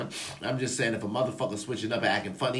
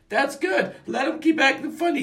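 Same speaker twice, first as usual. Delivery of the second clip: high mocking dismissal; 3 dB louder than usual. Real, not acted. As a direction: voice jumps high and light.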